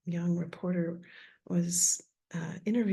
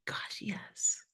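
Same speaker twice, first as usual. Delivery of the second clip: breathy whisper; 3 dB quieter, much slower than usual. Pretend. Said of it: "Is it just".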